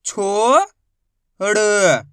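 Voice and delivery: loud, drawn-out syllables, each sounded separately; a teacher chanting sounds for kids to repeat; sounding out Hindi syllables for a class; slow phonics drill. Shown as a demonstration.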